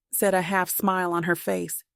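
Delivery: ordinary delivery, nothing marked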